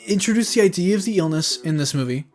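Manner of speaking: ordinary talk, nothing marked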